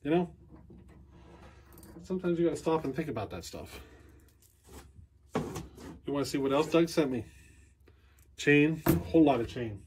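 usual speaking voice

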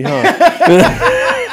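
high laughing huh; giggly awkward huh. Retold or imitated. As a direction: squeak it out with a laugh. Awkward movie comment, laughs.

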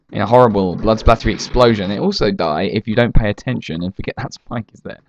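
usual speaking voice

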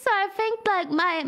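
ordinary talk

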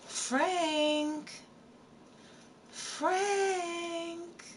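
high, drawn-out eerie call of his name; high, haunting call from a ghost girl; ghostly girl calling. Acted. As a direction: call him like a girl's voice from nowhere. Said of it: "He hears a girl's voice going".

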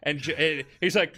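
ordinary talk

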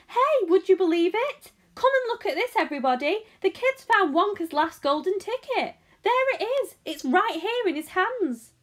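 high, loud shopkeeper calling everyone over; thrilled, high-pitched hollering; excited screaming. A narrator's reading. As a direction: shout the astonishing news to the whole shop. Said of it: "screamed the shopkeeper".

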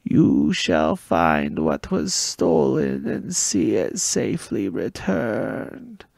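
slow, loud, eerie prophecy rasped out; raspy oracle voice. Acted. Gabe to the boy, raspy slow oracle prophecy. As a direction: intone it like a possessed card player. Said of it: "in the same voice".

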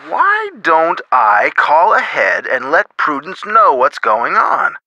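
ordinary delivery

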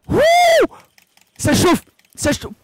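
loud, high excited yelp; excited shout. Real, not acted. Streamer yelling as his fire catches, excited. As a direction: cry out that it's burning.

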